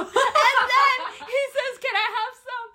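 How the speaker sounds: high, loud, squealing with laughter; cracking up. Real, not acted. Retelling creep's request to friend, cracking up. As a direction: force the quote out through laughter.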